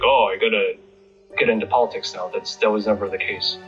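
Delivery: ordinary talk, nothing marked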